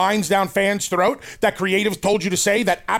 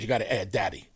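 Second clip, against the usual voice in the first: ordinary talk, nothing marked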